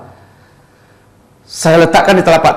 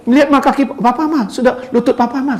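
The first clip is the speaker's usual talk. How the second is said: much higher voice, excitedly calling the wife; imitated amazed husband; 12 semitones higher than usual. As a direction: show her the healed knee, pitched high. Pasien takjub memanggil istrinya. (menirukan suami takjub dengan suara tinggi)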